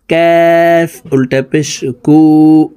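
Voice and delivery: usual speaking voice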